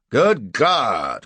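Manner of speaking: loud startled gentleman; shocked cry. A narrator's reading. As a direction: exclaim in shock. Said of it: "cries one of the men".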